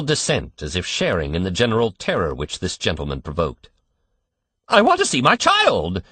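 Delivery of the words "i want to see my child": very high, loud, near-shrieked demand; almost scream it, close to collapse. A narrator's reading. Father on the verge of collapse, near-shrieked demand. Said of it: "Mister Button almost shrieked".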